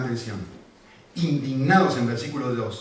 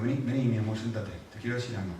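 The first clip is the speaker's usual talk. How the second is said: softer, gentle fatherly coaxing; tender to child; 2 semitones lower, 7 dB quieter, faster than usual. Retold or imitated. Father gently inviting his upset daughter to sit, soft and tender. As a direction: soothe a little girl onto your knee.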